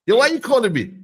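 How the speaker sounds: high, loud demand. Real, not acted.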